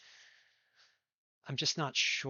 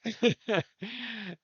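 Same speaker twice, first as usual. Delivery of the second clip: bright laugh; 10 semitones higher, 10 dB louder than usual. Real, not acted. Raider thanked.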